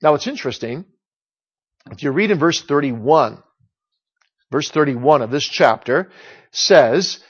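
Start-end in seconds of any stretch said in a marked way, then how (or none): none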